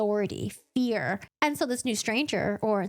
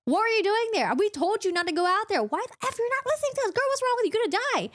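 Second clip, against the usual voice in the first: scolding outburst; 11 semitones higher, much faster than usual. Retold or imitated. Lucien scolding Feyre after grabbing her: frantic higher scolding. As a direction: tear into her like a panicked guardian. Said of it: "he's like".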